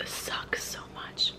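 soft whisper, close to tears; emotional whisper. Real, not acted. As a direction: breathe it out sadly. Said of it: "getting so into my feelings".

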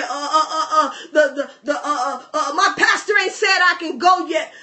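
mock hemming and hawing; stammering excuses. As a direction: fumble for an excuse with uhs. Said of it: "But here's Elijah".

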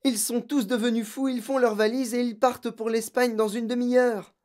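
high, urgent rush of news; frantic girl pouring it out; panicked. A narrator's reading. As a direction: blurt it out to the teacher in alarm.

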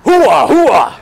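proud Army hooah. Retold or imitated. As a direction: bark it like a soldier. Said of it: "what the Army says".

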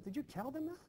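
can't believe she said it; incredulous demand. Acted. Master confronting servant girl in disbelief.

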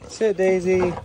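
high, loud command; calling a command. Real, not acted. Keeper telling Daisy the pig to sit, loud command. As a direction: call it out high to an animal.

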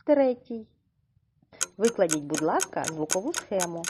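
ordinary talk, nothing marked

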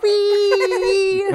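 high, drawn-out kiddie squeal; gleeful kid. Retold or imitated. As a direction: mimic a kid squealing on a joyride. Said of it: "he'd be like a kid".

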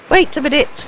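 loud, high call. Real, not acted. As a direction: yell after something running away. Calling after fleeing creature.

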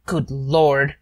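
ordinary delivery

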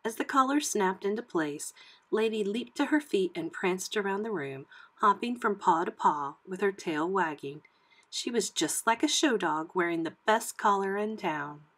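usual speaking voice

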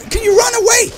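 high, loud panicked yell. Real, not acted. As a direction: shout it at the creature. Yelling at a fleeing enemy.

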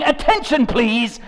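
normal talking voice

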